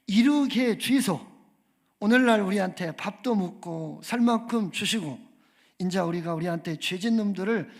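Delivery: 경상도 사투리로 투박하게 외치는 주기도문; Gyeongsang dialect. Pretend. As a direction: pray like a blunt Gyeongsang man for laughs. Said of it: "그런데 이제 경상도 버전".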